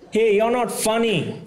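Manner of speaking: high, slow, laughing taunt; mock heckler jab. Drunk heckler telling comic he's not funny. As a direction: sneer at the comic.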